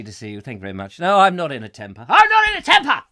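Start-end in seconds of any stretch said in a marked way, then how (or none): 1.0-2.1 mock denial of temper. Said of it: "Who said I was in a temper". 2.1-3.0 high, loud hammed-up shout of denial; a pretend tantrum yelled at the room; mock furious yell. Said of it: "Who said I was in a temper".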